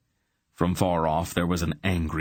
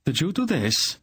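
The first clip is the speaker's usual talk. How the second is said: much higher, startled shout; 10 semitones higher than usual. A narrator's reading. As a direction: yell it over the storm. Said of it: "Silk shouted at Belgarath".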